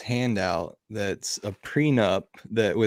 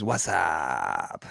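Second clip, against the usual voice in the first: long, low sliding "wassup"; drawn-out greeting; 4 semitones lower than usual. Retold or imitated. Reading Hex Rockstar's hello aloud, drawn-out greeting. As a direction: stretch it out, sinking in pitch. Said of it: "I think he said it that way".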